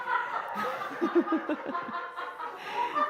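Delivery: breathless wheezing laugh; silent laugh. Real, not acted. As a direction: shake with soundless laughter. Still laughing at joke.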